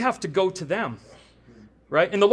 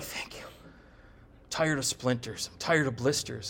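weary relief; 7 semitones lower, 8 dB quieter, slower than usual. Retold or imitated. Weary worker voiced, relieved.

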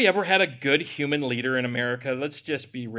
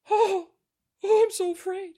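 high, whimpering mock terror; sarcastic fake cry of fright; mock fear; 14 semitones higher than usual. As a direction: ham up being scared of the government.

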